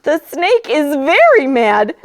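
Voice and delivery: plain reading voice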